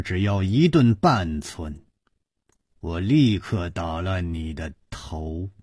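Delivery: low, hushed icy menace; cold threat. A narrator's reading. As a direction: threaten coldly in a low, quiet voice. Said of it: "冷冷地说". (压低声音冷冷地威胁)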